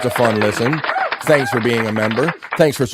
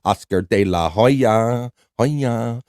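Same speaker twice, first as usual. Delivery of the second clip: Oscar De La Hoya stretched slowly; slow savoring read; much slower than usual. Shown as a demonstration. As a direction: draw out each syllable of Oscar De La Hoya. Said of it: "I like the variation".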